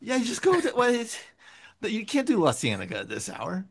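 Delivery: high-pitched mock L A freeway talk; Californians skit. L A transplant acting out route advice like the skit, mock Californian. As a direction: send up Californians giving driving directions, pitched high. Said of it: "I'm doing S N L".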